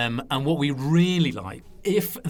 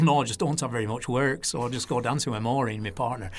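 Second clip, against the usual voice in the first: easygoing Lancashire impression; Lancashire accent; 2 semitones lower than usual. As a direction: put on a broad Lancashire voice. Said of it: "he talked like that".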